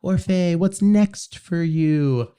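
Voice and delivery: slow, high mock-gushing fan voice; annoying fan question. Mocking an annoying fan asking a performer what's next. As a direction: drag out the question like a pestering fan. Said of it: "the annoying question of".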